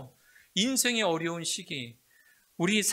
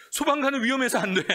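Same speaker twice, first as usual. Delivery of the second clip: high, loud panicked parent cry; alarmed shout; 11 semitones higher, 11 dB louder, faster than usual. Retold or imitated. Parents shouting at child's drawing, alarmed loud cry. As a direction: blurt it out in alarm, fast.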